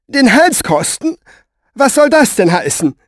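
very high, loud disbelief; aghast question. Acted. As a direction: demand an explanation in shock. She reacts aghast to his warning.

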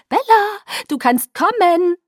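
very high, drawn-out summons; loud, high, lilting call; chirpy Alice figure. Acted. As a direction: sing out the invitation slowly.

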